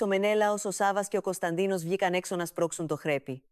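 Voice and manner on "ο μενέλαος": usual speaking voice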